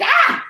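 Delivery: quick high squeal; fleeing to the other stall, a squeal; short high yelp. Retold or imitated. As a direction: yelp as you run.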